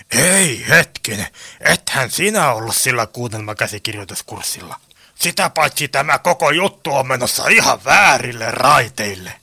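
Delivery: low-voiced crook objecting; Iso Turpa. (matalaääninen, vastaan väittävä roisto)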